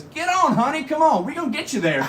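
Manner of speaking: friendly driver calling a passenger on; bus driver. Retold or imitated. Voicing the bus driver in a story.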